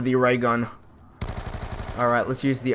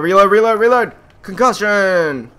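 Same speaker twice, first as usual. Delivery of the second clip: high, loud panicked shouting; frantic yell; 7 semitones higher, 7 dB louder than usual. Real, not acted. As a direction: yell at the gun to hurry. Weapon stuck while zombies close in.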